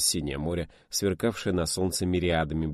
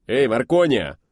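loud, higher bark across the cabin; barked call; 5 semitones higher, 8 dB louder, slower than usual. Acted. Pilot barks at the young radio operator. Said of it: "гаркнул Четверкин радисту".

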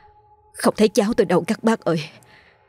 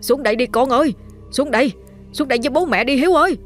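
put-on higher and higher, pleading call up to a child; frantic calling; 6 semitones higher, faster than usual. Parents calling their son down from the bamboo, frantic and rising. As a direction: call up to him, rising in panic. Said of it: "hoảng hốt kêu lên".

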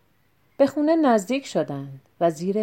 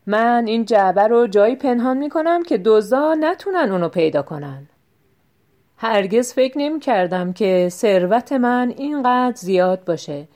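louder, a boastful show; loud, for the thieves; 2 semitones higher, 5 dB louder than usual. Acted. Tinal boasts loudly so the hiding thieves hear. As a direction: perform it loudly for hidden listeners. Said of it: "با صدای بلند گفت".

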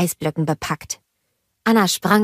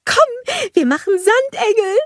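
much higher, louder bubbly snowman voice; cheerful snowman Olaf; 4 dB louder than usual. A narrator's reading. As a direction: bounce up to the kids full of joy. Olaf invites to sand angels.

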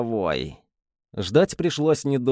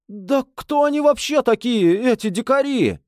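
much higher, indignant squeal; shrill high outrage; 11 semitones higher than usual. A narrator's reading. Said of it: "Голос его становился всё выше".

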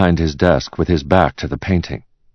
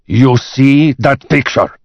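slow, loud, higher demand; angry boss; 9 semitones higher, 6 dB louder, slower than usual. Acted. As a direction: bark it slowly at the secretary. Enraged boss orders his secretary.

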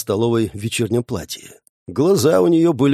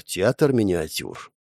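ordinary delivery

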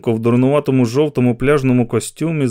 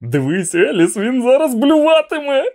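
much higher, louder excited call; gleeful spectator; 12 semitones higher, 4 dB louder than usual. Acted. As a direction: tell Alice to look, thrilled.

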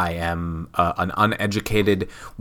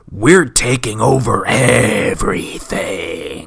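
slow, drawn-out mock villain declaration; a hammed-up wrestling takeover promo; villain faction boast; 4 dB louder, much slower than usual. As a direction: lay out the takeover line slowly like a heel faction. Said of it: "They say".

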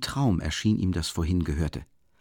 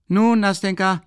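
calling out; 10 semitones higher, 6 dB louder than usual. A narrator's reading. Calls out to the maid.

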